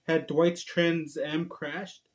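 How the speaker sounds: drawn out, very slow worry; slow worried wondering. Acted. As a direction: stretch every word in dread. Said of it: "he thought".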